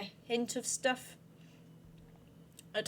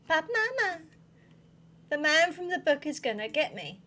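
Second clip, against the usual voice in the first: put-on higher-pitched little kid pleading; child's voice; 5 semitones higher than usual. Little one tells mama of the book man, childlike. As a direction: whine it like a child to mother.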